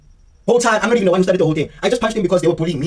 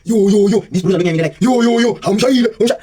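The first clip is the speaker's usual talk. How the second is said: louder, higher hype calling; mock crowd calling yo yo yo; 3 semitones higher, 4 dB louder than usual. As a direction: hype up the fight like older kids. Older kids calling out.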